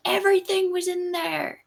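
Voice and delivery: high, hushed squeal of discovery; imitating amazed fans in a high voice; mock amazement. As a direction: pitch it way up, softly marvelling. Voicing fans' amazed reaction.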